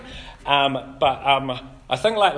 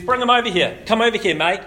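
casual call; 6 semitones higher, 5 dB louder than usual. Retold or imitated.

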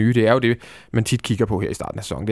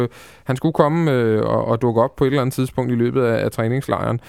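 normal talking voice

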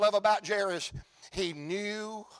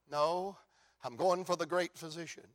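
softer, lower, calm conviction; quiet resolute answer; 3 semitones lower, 7 dB quieter than usual. Acted. Jairus answers the bystander, soft and low. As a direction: answer gently but firmly. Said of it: "And I can hear him say".

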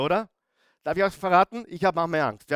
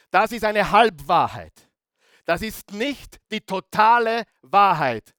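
raised, higher, louder pulpit voice; forceful preaching; 5 semitones higher, 6 dB louder than usual. Real, not acted. Preacher correcting a half-truth for the congregation, forceful and loud. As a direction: drive the point home with heavy emphasis.

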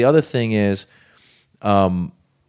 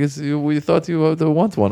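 high, earnest mock reporter; mock journalist voice; 7 semitones higher than usual. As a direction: play the eager local journalist, pitched up. Journalists voiced in retold story.

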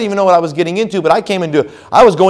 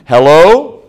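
a louder, overly alert hello on the phone; mock alert phone answer; pretending wide awake; 6 dB louder than usual. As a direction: fake being wide awake. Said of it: "pretend like we don't sleep".

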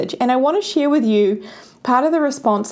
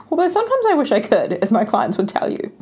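giggling, much higher aside; laughing aside; 6 semitones higher than usual. Real, not acted. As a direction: laugh through the joke. Laughing at her own joke.

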